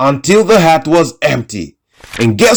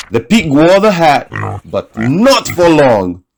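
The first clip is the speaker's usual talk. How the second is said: ordinary delivery, nothing marked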